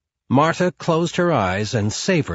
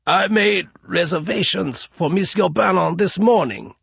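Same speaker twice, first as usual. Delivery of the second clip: higher, trembling plea of a man in pain; whimpering; 7 semitones higher than usual. Acted. Broken-fingered concierge whimpers answer to Marta, higher and frightened. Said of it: "he whimpered".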